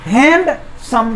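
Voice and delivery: Handsome slowly, with a gap after hand; split into syllables. Shown as a demonstration. Teacher showing it divides in two, split into syllables. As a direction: break handsome into two drawn-out beats. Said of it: "दो syllable".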